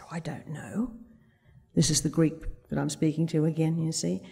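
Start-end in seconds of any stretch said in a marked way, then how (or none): 0.0-0.9 whispered, gentle admission; soft-spoken. Retold or imitated. Old Greek answering the hypnotherapist, soft-spoken whisper. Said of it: "is very soft-spoken".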